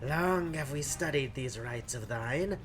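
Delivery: low, quiet, formal game-character voice; solemn occult companion. Acted. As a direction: intone it softly and low, with archaic gravity.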